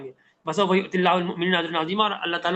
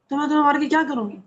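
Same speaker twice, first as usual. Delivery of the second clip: tender mother; 8 semitones higher, faster than usual. Retold or imitated. Mother voiced to child.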